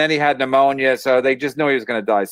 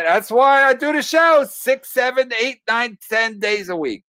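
high, drawn-out rant; worked-up declaration; 12 semitones higher, slower than usual. Real, not acted. Comic capping his deathbed story, worked-up high declaration. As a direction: pitch way up, stretch out the count.

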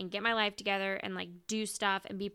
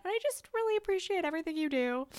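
teary thanks; 9 semitones higher than usual. Retold or imitated. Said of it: "I started to tear up".

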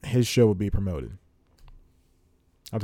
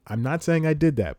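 pitched high, disclaiming "I did that"; coy disclaimer; 6 semitones higher than usual. Real, not acted. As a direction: lift "I did that" up high, as if denying credit. Denies credit, then claims it.

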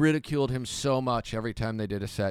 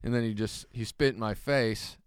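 ordinary talk, nothing marked

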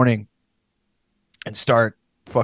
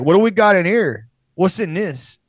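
mock Chicano cop, a touch higher; cop's Mexican accent; 5 semitones higher, 4 dB louder than usual. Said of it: "a guy sounds like George Lopez".

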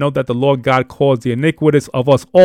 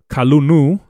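normal talking voice

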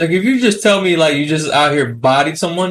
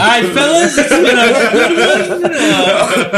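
put-on old prospector; 5 semitones higher, slower than usual. Mock leaving the awkward chat.

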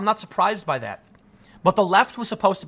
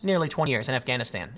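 ordinary delivery

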